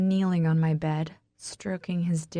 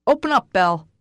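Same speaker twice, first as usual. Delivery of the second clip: loud, higher-pitched demand; calling through door; 4 semitones higher, 8 dB louder, faster than usual. A narrator's reading. Man pounding on the door to Belle, shouting. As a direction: call out forcefully to be let in.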